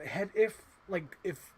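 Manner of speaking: ordinary talk, nothing marked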